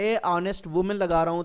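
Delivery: usual speaking voice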